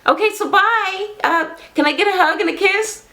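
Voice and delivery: high, hopeful mock voice; mock innocent boyfriend. Boyfriend asking his girlfriend for a goodbye hug, high and mock hopeful. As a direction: ask for a kiss like nothing happened.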